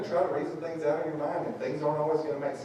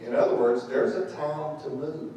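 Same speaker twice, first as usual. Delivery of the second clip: ordinary talk, nothing marked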